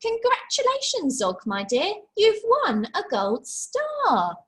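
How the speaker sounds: award the star proudly; Madam Dragon proud congratulations; praising teacher. Acted. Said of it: "said Madam Dragon".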